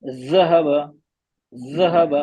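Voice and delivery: gently touch the teeth for ज़हब; right pronunciation; correct zahab. Shown as a demonstration. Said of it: "अब ये सही है".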